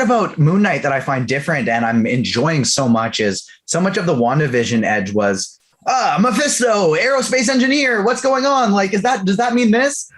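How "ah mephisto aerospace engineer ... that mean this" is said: high, breathless, puzzled rush; confused fan. Retold or imitated. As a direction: play a baffled fan guessing theories. Mimics confused WandaVision viewer.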